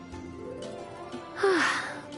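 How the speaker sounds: long, soft, airy sigh; contented sigh. Acted. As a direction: breathe out a long sigh of pleasure. Savouring perfect weather for tea.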